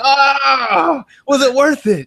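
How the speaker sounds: high, loud anguished cry; mock anguish. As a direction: wail it in hammed-up parental grief. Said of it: "I'm like".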